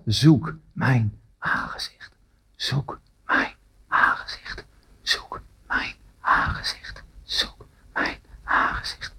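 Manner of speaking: soft breathy whisper, calling again and again; God calling in the heart, a hushed whisper. Acted. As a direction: whisper it like an inner call from God. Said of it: "de roepstem van zijn God".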